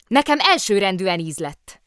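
loud, hearty call; calling across. A narrator's reading. Trumpeter calling over to the bear, loudly. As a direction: pitch it across the room.

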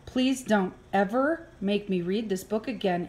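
usual speaking voice

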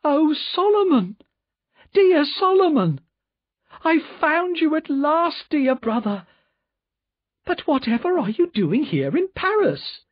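high, loud delighted cry of recognition; calls out to a long-lost brother; loud joyful cry. A narrator's reading. Miss Pross crying out to her found brother, high and overjoyed. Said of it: "cried out in a loud voice".

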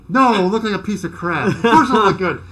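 loud mock-exasperated comeback; hammed-up indignant reply; sarcastic retort. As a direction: answer a silly question with loud sarcasm, laughing. Retorts to boss's doubting question.

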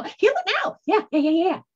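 very high squeal then quick yeahs; squeaky soul voice. Retold or imitated. The soul insisting on healing, squealing. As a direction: squeak it up like a cartoon voice. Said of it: "your soul saying".

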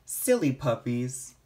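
ordinary delivery

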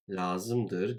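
usual speaking voice

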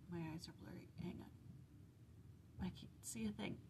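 real whisper while looking for the page; soft whispered muttering. Real, not acted. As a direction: hush it, half to yourself. Whispers while finding the chapter.

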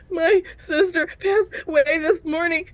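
high, choppy tearful whimper; imitated sobbing. Telling coworkers about her sister, imitated sobbing. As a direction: act out getting words through sobs.

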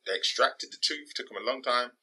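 usual speaking voice

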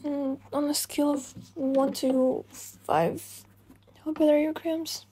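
usual speaking voice

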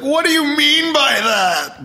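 high, drawn-out mock outrage; mock indignant. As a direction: stretch it out, high and offended for the joke. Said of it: "the easy joke would be to like".

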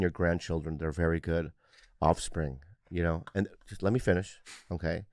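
low, soft, earnest apology; mock sincere. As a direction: lay on the fake sincerity, quietly.

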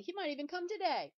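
overcompensate, shouting down the fear; too loud. Acted. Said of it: "he said too loudly".

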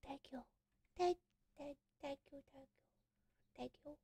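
very faint, low, hushed gratitude; overwhelmed whisper. Real, not acted. Streamer close to crying thanks a giver, overwhelmed whisper. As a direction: whisper thanks with hardly any voice.

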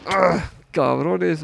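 sudden pained cry; startled yelp. Real, not acted. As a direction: shout at being hit. Hit by shots.

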